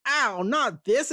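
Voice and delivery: high, drawn-out goofy yelp; mock pained cry. Host voices Cliffjumper shot in face, mock pain. Acting out shot character's reaction.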